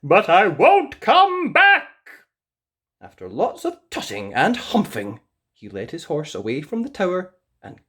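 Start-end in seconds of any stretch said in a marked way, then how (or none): 0.0-1.9 high, loud huffy prince; sulky prince. A narrator's reading. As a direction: give in with a huff. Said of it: "said the prince".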